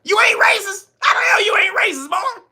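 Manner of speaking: very high, incredulous squawk; mock disbelief. Host playing a critic doubting him, high mock disbelief. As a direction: fire it back high in hammed-up outrage.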